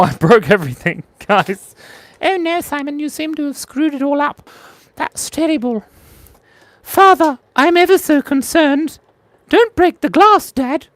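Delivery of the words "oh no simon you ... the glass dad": much higher, fussy and ever so proper; fussy posh child voice. Acted. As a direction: play a prim little child fretting at his father.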